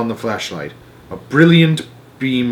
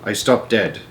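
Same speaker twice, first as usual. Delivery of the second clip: usual speaking voice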